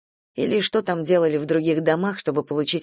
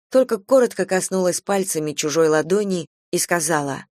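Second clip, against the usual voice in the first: usual speaking voice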